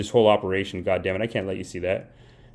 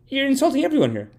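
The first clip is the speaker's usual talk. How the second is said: fast, high-pitched indignant reproach; comically offended, voice shooting up; mock indignation; 14 semitones higher, faster than usual. Acted reproach in family quarrel.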